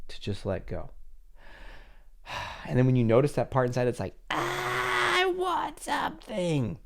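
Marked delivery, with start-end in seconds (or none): 4.3-6.8 very high, drawn-out needy wail; slow, high-pitched mock pleading; whiny inner child. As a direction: whine it like a desperate little part inside. Voicing a needy part inside.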